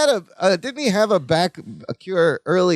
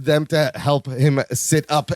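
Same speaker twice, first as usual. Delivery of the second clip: ordinary delivery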